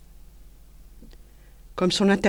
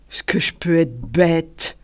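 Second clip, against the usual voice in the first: slow, lightly declaimed self-reproach; a hint of orator; mock oratorical; slower than usual. A narrator's reading. Paul turning it into speech, slow mock oratory. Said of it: "en choisissant la seconde voie".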